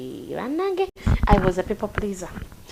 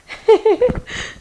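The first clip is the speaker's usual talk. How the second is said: short laugh; 5 dB louder than usual. Real, not acted. Laughs while checking phone.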